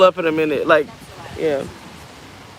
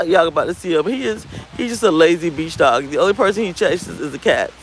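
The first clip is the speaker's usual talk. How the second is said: usual speaking voice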